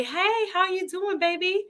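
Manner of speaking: put-on high sing-out greeting to a little child; high-pitched baby-talk hello; cooing to a toddler. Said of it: "when I say".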